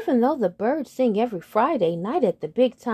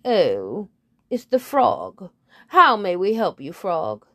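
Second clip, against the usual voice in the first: a touch of haughty bird, louder; lightly voiced head bird; 5 dB louder than usual. Head bird greeting the frog from the window, mildly haughty. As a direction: look down on him politely. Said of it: "and says".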